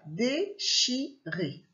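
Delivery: "Déchiré" split slowly into dé-chi-ré; syllable by syllable. Shown as a demonstration. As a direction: spell out the three syllables of "Déchiré". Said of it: "il fait trois syllabes".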